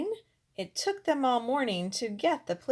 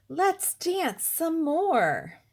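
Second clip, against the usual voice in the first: high, loud and slow pleading; a little prince begging for more dancing; 8 semitones higher, 4 dB louder, slower than usual. Acted. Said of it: "who begged".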